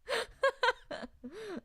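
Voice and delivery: giggling laugh. Real, not acted. Laughs after impression.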